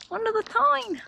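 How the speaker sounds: "Under the Tyne!" thrown up high with delight; excited. Real, not acted. Cyclist thrilled riding the tunnel, squeals "Under the Tyne!". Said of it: "How cool's that though?".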